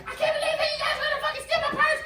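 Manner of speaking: high, fast shrieking woman voice; crazed accusation. Retold or imitated. Woman accusing the staff of stealing her purse, high shriek. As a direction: screech it like she's lost it.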